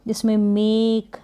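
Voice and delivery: "मेक" slow with a long ए; slow demo word. Shown as a demonstration. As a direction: stretch the long vowel in "मेक". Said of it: "देखिए long ए". (लंबा ए खींचकर, धीमे से "मेक")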